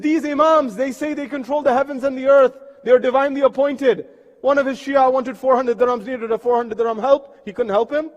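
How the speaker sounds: loud, high retold denunciation; indignant accusation. Gossips denounce the Imams.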